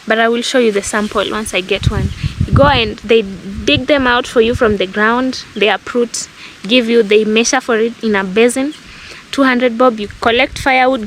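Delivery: ordinary talk, nothing marked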